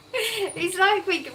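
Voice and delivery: high squealing laugh into words; helpless laughter. Real, not acted. Cracking up at a chat comment, tearful laughter. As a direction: crack up till it sounds like crying.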